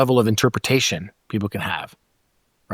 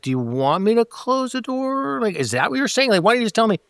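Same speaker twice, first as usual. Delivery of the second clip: exasperated question; 9 semitones higher than usual. Retold or imitated. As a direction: press them to just say it. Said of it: "It's like".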